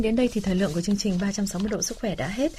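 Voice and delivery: ordinary talk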